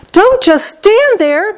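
high, slow, exasperated cry; screaming. A narrator's reading. Wife urges her idle husband, screaming. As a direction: berate him in a high, drawn-out voice. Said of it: "Missus Gloop screamed at Mister Gloop".

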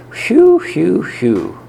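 high, loud sighs of relief; relieved whew. Acted. Hamster relieved after danger.

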